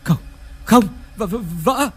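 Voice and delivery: panicked cry. Acted. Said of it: "hốt hoảng sợ sệt".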